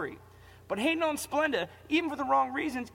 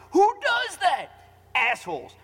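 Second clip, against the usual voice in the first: loud, high furious outburst; outraged shout; 6 semitones higher, 7 dB louder, slower than usual. Real, not acted. Ranting fan shouting at the coaches' calls. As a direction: yell it in disbelief. Angry rant about coaching.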